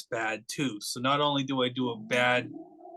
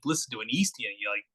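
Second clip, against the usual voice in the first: usual speaking voice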